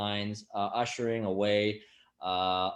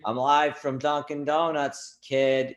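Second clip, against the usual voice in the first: put-on loud, higher Boston bro accent; 5 semitones higher, 6 dB louder, slower than usual. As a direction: play a loud Boston guy live from Dunkin'. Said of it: "that's not even my real voice".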